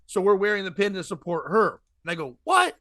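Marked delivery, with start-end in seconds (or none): 2.4-2.8 surprised. Retold or imitated. Acting shocked at the pageant.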